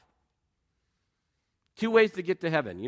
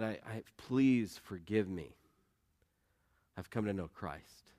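softer, slow, broken plea for forgiveness; a hushed, halting retold sob; broken pleading; 6 semitones lower, 8 dB quieter, slower than usual. Cruel boss begging the father's forgiveness, softly pleading. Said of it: "just sobbed on my dad's shoulder".

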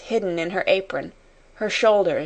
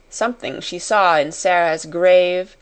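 usual speaking voice

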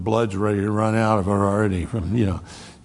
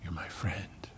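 whispered; 4 semitones lower, 14 dB quieter than usual. Pretend. Jesus speaking to him, whispered.

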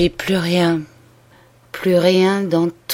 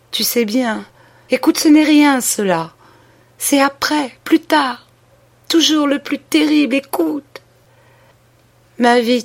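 raised, pleading voice of a dying woman; dying sister's confession; 4 semitones higher than usual. Acted. Dying woman begs her sister's pardon, pleading and raised.

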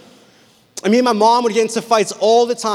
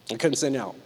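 ordinary delivery

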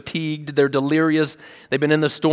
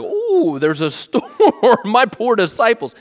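high, airy and amused, spotting the storm; mock-casual Jesus; 8 semitones higher, 5 dB louder, slower than usual. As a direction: play Jesus chuckling lightly at the storm.

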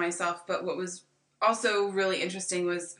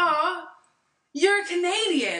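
high, loud sloshed Aussie bogan; drunk Aussie accent; 10 semitones higher, 4 dB louder, slower than usual. Retold or imitated. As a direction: imitate a tipsy Australian, pitched way up. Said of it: "You have a better Aussie accent".

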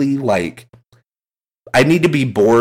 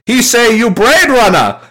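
put-on high, loud Chinese-accented movie quote; hammed-up Chinese accent, pitched way up; imitated Chinese accent; 13 semitones higher, 5 dB louder than usual. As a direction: shout it out in a mock Chinese accent. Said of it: "this is exactly how he says the line".

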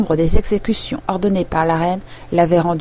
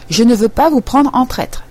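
higher, louder angry yell; 5 semitones higher, 5 dB louder than usual. A narrator's reading. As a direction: shout it at her, stamping. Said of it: "hurla la reine en tapant du pied".

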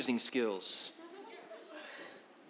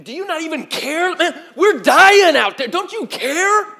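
accusing yell; 12 semitones higher, 12 dB louder than usual. Retold or imitated. Disciples pointing at Jesus and yelling, accusing. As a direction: point the finger and scream it. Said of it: "They point a finger".

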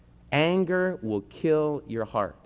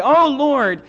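high, loud desperate plea to God; anguished prayer, pitched high; pleading cry; 11 semitones higher, 4 dB louder than usual. Acted. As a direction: beg the Lord with a high, cracking voice. Preacher voicing a desperate plea.

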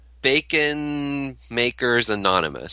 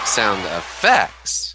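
slow "effects", second syllable punched; stress on second syllable; 4 semitones lower, much slower than usual. Retold or imitated. Mocking the contestant, "effects" stressed on its second syllable. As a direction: draw out "effects", leaning hard on its end. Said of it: "put the emphasis on the other syllable".

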